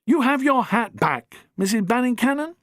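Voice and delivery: high, puzzled questioning voice; ask, confused; puzzled Doctor. Acted.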